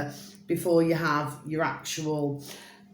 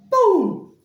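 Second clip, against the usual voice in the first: high, loud shouted boom; explosive boom; 7 dB louder than usual. Pretend. Describing sudden chaos, a loud high boom. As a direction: blast it out like an explosion.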